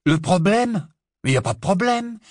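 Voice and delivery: high, loud mock-surprised retort; indignant denial. Ron answers Harry asking what the problem is, indignant. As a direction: throw the question back, pitch leaping up.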